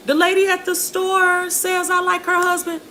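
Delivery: put-on high, teary little-girl complaint; crying child. Crying girl tells her mother about the store lady. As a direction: imitate a crying kid telling mom. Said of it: "I was crying and I went home".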